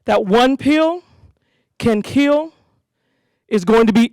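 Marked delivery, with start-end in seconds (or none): none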